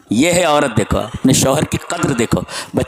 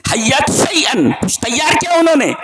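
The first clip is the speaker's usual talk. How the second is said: raised preaching cry; 11 semitones higher than usual. Acted. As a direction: cry the words out to the crowd. Repeated louder and higher.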